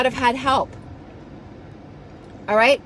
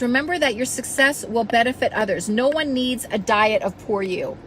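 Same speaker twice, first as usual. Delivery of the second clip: ordinary delivery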